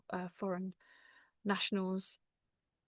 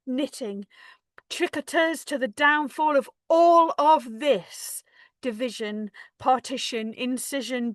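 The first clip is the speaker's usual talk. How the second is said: loud, forceful spoken-word climax; rousing protest-poem delivery; impassioned poem recitation; 4 semitones higher, 10 dB louder, slower than usual. Acted. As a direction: drive the list out louder, word by hammered word.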